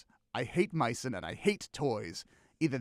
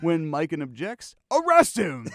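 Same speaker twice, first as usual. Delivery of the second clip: commanding shout; 2 semitones higher, 9 dB louder than usual. Acted. Dictator orders arrest.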